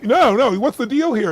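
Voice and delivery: lightly voiced pushy girlfriend, high and loud; insistent haggler. Girlfriend presses camera seller for a deal, insistent and loud. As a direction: brush him off and press for a deal. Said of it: "she's like".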